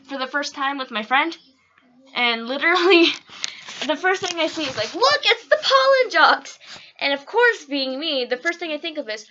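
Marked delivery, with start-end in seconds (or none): 4.9-6.6 high, loud, slow gleeful cry of "Look, it's the pollen jocks!"; excited squeal. Retold or imitated. Retelling watching Bee Movie with her friend, high excited squeal. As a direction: squeal it like a thrilled kid spotting them.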